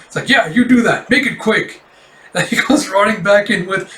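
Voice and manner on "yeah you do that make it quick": flustered order. Acted. Flustered master hurrying apprentice.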